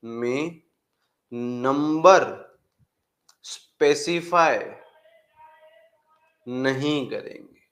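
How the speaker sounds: each word spaced out for writing; slow dictation pace, word by word. Shown as a demonstration. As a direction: dictate slowly with long pauses. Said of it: "आप लोग भी लिखते हुए चलिएगा".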